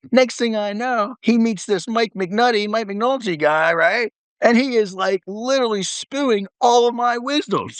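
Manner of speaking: high-pitched, incredulous dad impression; Coy Blevins. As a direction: act out a father's comic indignation. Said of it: "He goes".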